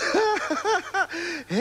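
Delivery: high burst of laughter; gleeful laugh. Acted. Moffel about to announce it's finished, gleeful laugh. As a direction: laugh before revealing the news.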